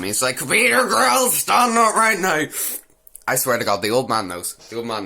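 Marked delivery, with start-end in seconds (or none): 0.4-2.5 much higher, drawn-out angry order; mock furious command. Father about to kill the suitor, mock furious yell. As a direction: imitate an enraged father demanding obedience. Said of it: "about to kill him".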